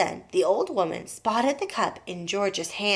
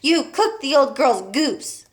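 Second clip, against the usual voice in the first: glaring accusation; 5 semitones higher, 5 dB louder, slower than usual. A narrator's reading. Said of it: "glaring at her husband".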